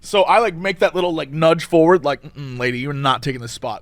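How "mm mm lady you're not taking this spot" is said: low, soft mock warning to the lady. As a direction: murmur it low, a half-joking threat. Retold standoff with the woman driver.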